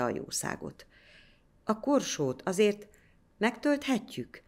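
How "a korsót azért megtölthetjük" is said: put-on small, higher timid child's voice; timid question. A narrator's reading. Older boy asking the priest timidly, higher and small. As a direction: ask shyly, afraid of the priest. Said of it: "kérdezte bátortalanul az idősebb".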